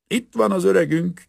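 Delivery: loud, high, hearty shout; shouted greeting. A narrator's reading. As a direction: call out loud to the old man. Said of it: "kiáltotta az egyik vízvezetékszerelő". (harsány, magas kiáltás)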